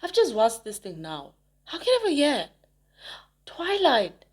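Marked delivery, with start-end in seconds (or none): 1.7-4.2 loud, high burst of remembering; finally remembering the movie name, excited; excited recall. Real, not acted. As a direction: shout the title as it comes back. Straining to recall movie title.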